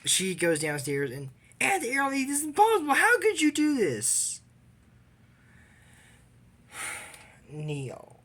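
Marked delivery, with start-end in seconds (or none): 1.6-4.4 high, pleading upset voice; high whiny outrage, slowing at the end; upset girl. Retold or imitated. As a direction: act out the outraged niece. Eliza confronting aunt, retold.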